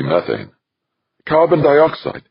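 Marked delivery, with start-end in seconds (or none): 1.3-2.2 high, loud echoed question; incredulous. A narrator's reading. Westfall echoing Devlin's answer, incredulous. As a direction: repeat it back in high-pitched disbelief.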